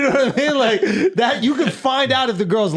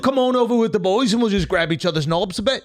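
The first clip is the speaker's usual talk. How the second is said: laughing, hammed-up British gent; a bit higher, a posh British chap laughing at his own offer; mock British accent; 4 semitones higher than usual. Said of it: "That's a good accent".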